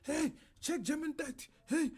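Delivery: high mock voice of a nagging chat viewer. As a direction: parrot a pestering stream viewer in a silly high voice. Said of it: "someone like".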